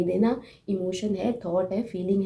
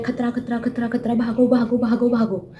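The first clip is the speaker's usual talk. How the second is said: rapid chanted danger warning, getting louder; panicky amygdala alarm; 3 semitones higher, 5 dB louder than usual. Acted. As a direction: sound the alarm in mock panic. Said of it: "तो amygdala बोलता है".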